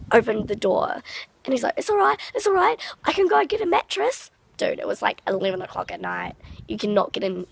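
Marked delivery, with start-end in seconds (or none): 1.8-4.3 high, rushed mock-panicked reassurance; imitating his freak-out. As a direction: mimic a man frantically trying to calm someone. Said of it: "he started freaking out himself".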